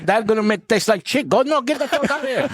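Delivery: high-pitched laughing mock rant; imitating A J. A J chasing the blender off, high and laughing. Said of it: "A J's probably like".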